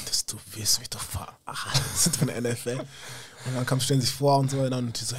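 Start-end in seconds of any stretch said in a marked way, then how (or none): 0.1-2.8 hushed, half-laughing disbelief; stunned whisper. Retold or imitated. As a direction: whisper it like it's too good to be true. Retold disbelief, scouts present. (fassungslos geflüstert, halb lachend)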